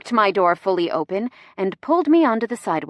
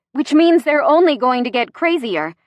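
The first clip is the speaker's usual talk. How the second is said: higher and louder, giddy; giddy, near hysterical; 5 semitones higher, 5 dB louder than usual. A narrator's reading. Gemma breathless over the reporters, mildly hysterical. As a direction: let the hysteria creep up a little. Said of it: "I'm laughing so hard by this point".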